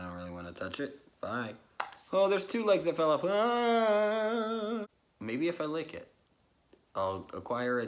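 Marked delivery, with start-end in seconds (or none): 3.2-4.8 much higher, short alarmed yelp; startled yelp. Real, not acted. Vlogger as more legs fall off, yelping in fright.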